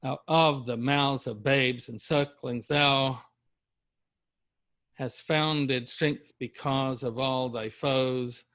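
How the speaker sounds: slow, each syllable counted out; metered reading. Shown as a demonstration. Teacher counting syllables for class, slow and metered. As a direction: tap out the meter. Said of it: "ten syllables on average per line".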